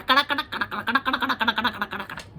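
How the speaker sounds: kada, kada rattled fast, fading away; cracking kada. Acted. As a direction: rattle off kada like breaking bones. Backbone-cracking sound effect.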